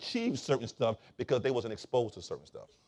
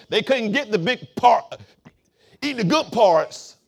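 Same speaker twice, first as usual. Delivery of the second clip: loud, emphatic, raised-pitch sermon delivery; forceful preaching; 5 semitones higher, 9 dB louder than usual. Real, not acted. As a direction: hammer the story out to the congregation.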